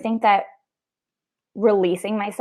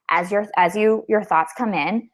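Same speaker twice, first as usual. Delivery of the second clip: guided meditation voice; 3 semitones higher, slower than usual. Retold or imitated. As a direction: drift it out like a calm app narrator. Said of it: "Have you been practicing your guided meditation voice?".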